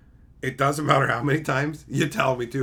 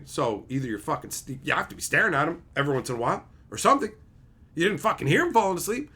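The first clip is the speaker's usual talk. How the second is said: ordinary talk, nothing marked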